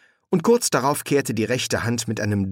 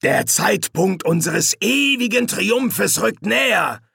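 high, slow proclamation; drawn-out villainous fervour; grandiose demon; 10 semitones higher, 4 dB louder than usual. Acted. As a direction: declaim the triumph grandly. Hastur proclaims eternal triumph.

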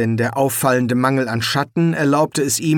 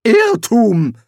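high, loud retort; sharp, raised-voice objection; sharp contradiction; 11 semitones higher, 6 dB louder than usual. A narrator's reading. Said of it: "widersprach Rincewind scharf".

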